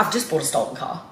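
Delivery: hushed, low, stunned realisation; small voice, dropped low in shock. Retold or imitated. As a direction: let it sink in quietly.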